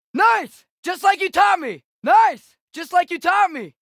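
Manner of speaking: shouted high combat calls to a teammate; battle callouts. Acted. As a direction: yell it over the fight, pumped up.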